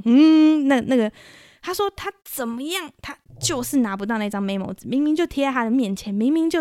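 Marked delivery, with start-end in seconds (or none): 0.0-0.7 jolt grunt. Retold or imitated. Imitates gear-shift jolt.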